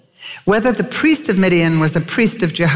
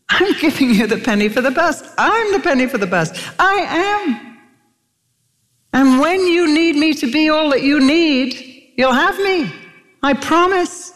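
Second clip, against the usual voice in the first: reassuring God; 10 semitones higher than usual. Acted.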